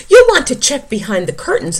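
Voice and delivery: loud, fast urging; nervous bear. Acted. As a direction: hurry the mouse along.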